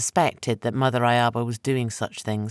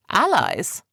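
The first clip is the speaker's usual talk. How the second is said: incredulous; 8 semitones higher, 4 dB louder than usual. A narrator's reading. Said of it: "In what?".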